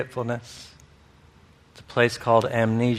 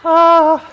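a high, loud burst of delight; a loud, high cry of glee at the picture; delighted high exclamation; 11 dB louder than usual. Real, not acted. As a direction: yelp out in amused wonder.

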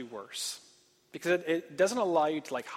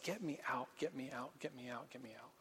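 each get me out softer until almost gone; fading plea; 4 semitones lower, 17 dB quieter than usual. Retold or imitated. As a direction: let the plea die away to nothing. Voicing his heart's plea.

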